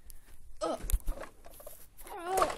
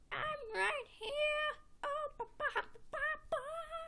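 pet dinosaur; 12 semitones higher than usual. Pretend. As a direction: pipe up as a tiny singing dinosaur. Pet dinosaur answers in the song.

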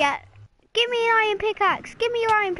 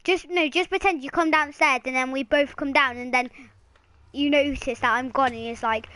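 usual speaking voice

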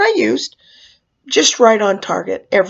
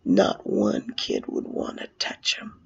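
soft, near-whispered storytelling; hushed aside; 11 dB quieter than usual. Acted. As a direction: let it sink to a hush. Hushed punchline after scary retelling.

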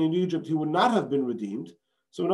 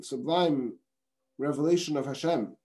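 usual speaking voice

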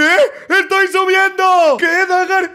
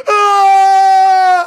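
loud high scream of panic; panicked scream; 5 semitones higher, 9 dB louder than usual. Acted. Nacho screams as he flees Lía's invitation, loud scream. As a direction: scream and run away.

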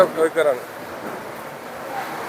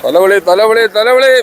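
sharp piercing fish seller; 4 semitones higher, 3 dB louder, slower than usual. Real, not acted. Fish seller calling out at harbour.

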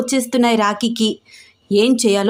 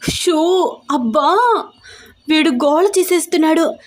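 higher, flustered and worried; panicking he will wake everyone, higher; 6 semitones higher than usual. Acted. Raksha, worried the aunt will wake, flustered and higher. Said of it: "కంగారు పడిపోతూ".

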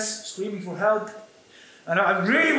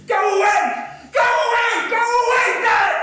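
put-on high, loud panicked child screams; boy screaming at his father to go away; 13 semitones higher, 7 dB louder than usual. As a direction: scream at dad to leave, terrified. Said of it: "I just start screaming".

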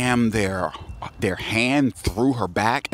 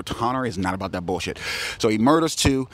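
ordinary delivery, nothing marked

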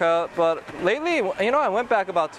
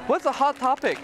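teacher voice; 9 semitones higher than usual. Retold or imitated. Teacher voicing question to students.

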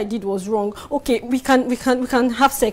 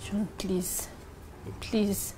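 soft pleading; 3 semitones lower, 7 dB quieter than usual. Acted. Wife begs husband.

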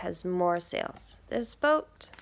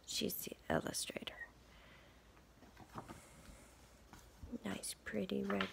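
whispered aside; 13 dB quieter than usual. Real, not acted. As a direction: share it in a hush. Aside while showing book pages.

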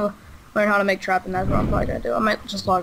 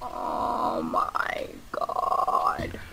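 slow shocked whisper; 6 dB quieter, much slower than usual. Real, not acted. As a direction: breathe it out in disbelief.

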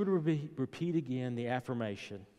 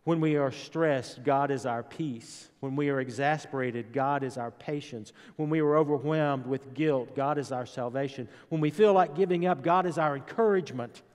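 building sermon; 2 semitones higher, 5 dB louder than usual. Acted. Preaching builds to full force.

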